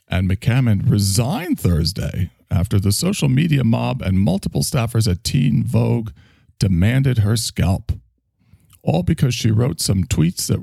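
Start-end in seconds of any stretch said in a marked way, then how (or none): none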